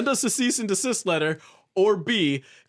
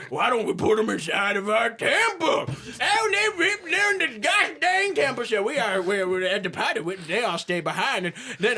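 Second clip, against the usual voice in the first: hillbilly recap; 10 semitones higher than usual. Acted. Game master to listeners, high folksy hillbilly recap. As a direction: rattle off the tale like a country yokel.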